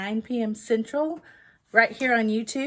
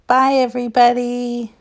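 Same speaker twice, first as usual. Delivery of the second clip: loud goodbye; 3 semitones higher, 12 dB louder than usual. Real, not acted. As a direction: call out the goodbye. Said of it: "And we can't forget [name]".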